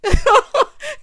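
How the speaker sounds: short loud high-pitched laugh; cackle. Real, not acted. Teasing her word choice, cackling.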